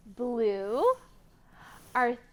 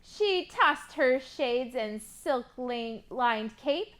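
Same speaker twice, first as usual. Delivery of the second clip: rhyming picture book read with lively lilt; reading to children; slower than usual. Acted. Teacher reading a rhyming book to kids, lively. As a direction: read the rhymes to a class of kids.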